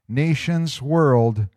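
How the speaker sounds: usual speaking voice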